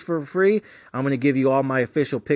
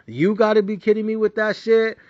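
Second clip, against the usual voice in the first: exasperated outburst; 8 semitones higher, 3 dB louder than usual. Real, not acted. Ranting about USC interceptions.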